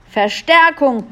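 high, loud, drawn-out call; bellowing. A narrator's reading. Little men behind the wall, bellowing for backup. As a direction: bellow for help over a distance. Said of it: "hörte Julia die Heinzelmänner auf der anderen Seite brüllen".

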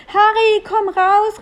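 high, ringing call. A narrator's reading. Elf calls the prisoner to the window, shouting high. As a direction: call loudly to someone far away. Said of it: "rief Melissa".

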